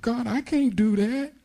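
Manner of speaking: high, whining cry that it's too hard; whiny complaining believer. Retold or imitated. Preacher voicing a believer who tells God it is impossible, high whine. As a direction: complain to God like a helpless child.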